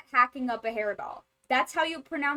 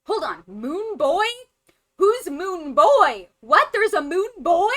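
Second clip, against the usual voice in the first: loud, rising disbelief; baffled and very loud, pitch swooping; incredulous outburst; 6 semitones higher, 10 dB louder than usual. Real, not acted. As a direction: demand answers, voice leaping up on the name. Stunned by a new name, Moon Boy.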